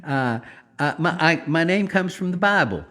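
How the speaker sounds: sweetly imitating a Southern girl; lightly voiced Southern girl introducing herself; Southern accent. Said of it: "she's a little Southern".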